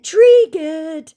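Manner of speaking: high, loud, put-on taunt; sing-song jab at the scammer.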